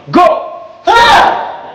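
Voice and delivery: high piercing battle cry; aggressive shout. Real, not acted. Coach counting in and roaring the punch with students. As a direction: attack with everything. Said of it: "I need to see aggression".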